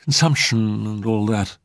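vague sighing. A narrator's reading. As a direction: let it drift out vaguely. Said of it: "sighed the lady vaguely".

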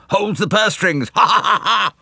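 high, gleeful echo breaking into laughter; chuckling old gentleman. A narrator's reading. Old gentleman echoing Poirot, chuckling into laughter. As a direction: repeat it with a high old man's cackle. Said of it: "chuckled the old gentleman".

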